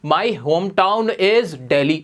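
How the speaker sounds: stretched very slowly, each word apart; slow model phrase. Shown as a demonstration. Teacher modelling a new phrase for students, very slow. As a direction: draw the phrase out for the class. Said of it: "आप बोल सकते हो".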